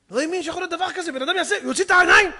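very fast, high, astonished rush; retold shock. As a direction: gabble it in high disbelief. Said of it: "הזדעזע". (תדהמה, מהר ובקול גבוה)

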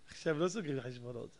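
very soft, low, quick aside; hushed calming reply. Acted. Preacher talking down the angry man, hushed and calm. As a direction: drop right down after a small laugh.

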